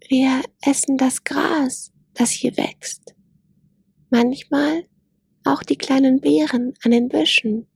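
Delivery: a shy fawn explaining its food; young gazelle voice. Acted. Gazelle character voice.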